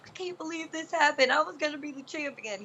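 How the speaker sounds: high-pitched whiny imitation of crying; hammed-up tearful lament without real sobs; mock crying. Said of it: "He's crying".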